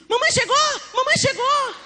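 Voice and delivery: high-pitched gleeful cries; squealing, eager children's cheer; excited children. Retold or imitated. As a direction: imitate little kids announcing Mum is home. Little boys cheer mother's arrival.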